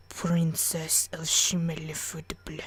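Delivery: very slow, low creaky whisper; slow, breathy comic reveal; mock-mysterious aside. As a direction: draw the title out in a hushed voice. Silly whispered answer to question.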